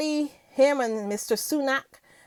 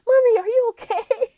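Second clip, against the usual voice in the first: put-on high, loud child's voice through laughter; imitating daughter; 11 semitones higher, 7 dB louder than usual. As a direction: mimic a concerned little daughter, giggling. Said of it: "my daughter was like".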